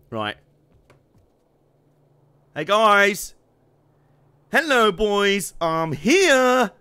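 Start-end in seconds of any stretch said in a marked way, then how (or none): 2.6-6.7 put-on loud, high hail to the boys; mock-cheery calling out. Gamer greeting enemies as he drops in, mock-cheery shout. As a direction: announce yourself loudly to the whole gang.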